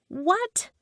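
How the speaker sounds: shocked. A narrator's reading. Narrator hears friend was in Italy, shocked. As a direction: blurt it in shocked disbelief. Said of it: "I said, shocked".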